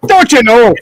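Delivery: exaggerated Minnesota singsong; Minnesota accent. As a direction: play up the Upper Midwest accent. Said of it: "You hear somebody go".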